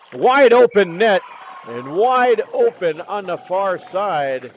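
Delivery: high, loud burst on the goal; excited goal call. Real, not acted. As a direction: call the open net with excitement.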